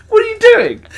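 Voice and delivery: high, loud disbelieving cry; laughing disbelief; incredulous shriek. Retold or imitated. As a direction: shriek it in shock. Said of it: "it was just like".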